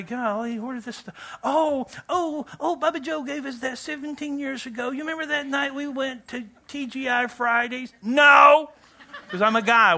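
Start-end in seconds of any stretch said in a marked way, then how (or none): 0.0-7.9 lightly voiced sentimental spouse, higher; mock nostalgic wife. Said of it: "you look at that stuff and say". 8.0-8.7 loud, high "No!"; emphatic shout. Real, not acted. Answering the remembered question.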